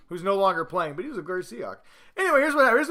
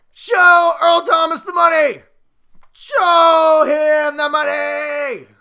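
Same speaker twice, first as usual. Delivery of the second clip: much higher and louder, slow bellowed movie line; Jerry Maguire shout; 8 semitones higher, 9 dB louder, much slower than usual. Retold or imitated. Vlogger demands a new contract for Earl Thomas, slow mock shout. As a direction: yell it slowly like the famous Jerry Maguire scene. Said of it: "or you can do the Jerry Maguire".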